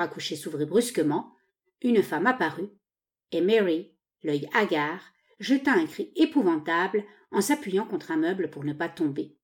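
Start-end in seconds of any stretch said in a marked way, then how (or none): none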